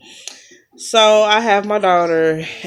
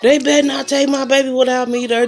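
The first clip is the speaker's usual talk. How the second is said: imitating husband hollering; 5 semitones higher than usual. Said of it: "And he hollering".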